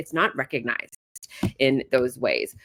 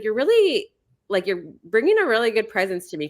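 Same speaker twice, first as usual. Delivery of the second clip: high, louder thankful friend voice; a hint of the grateful friend; mock gushing thanks; 6 semitones higher, 4 dB louder than usual. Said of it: "she's like".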